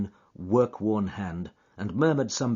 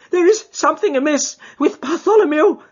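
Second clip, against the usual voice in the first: high, loud, panicked cry; a frightened man cries out for help; terrified cry; 10 dB louder than usual. Acted. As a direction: shriek it out in terror. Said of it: "he cried".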